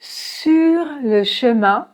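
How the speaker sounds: Sur le chemin slowly, light final R in sur; slow demo; slow model. Shown as a demonstration. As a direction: draw out sur. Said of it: "Remarquez également ici dans sur".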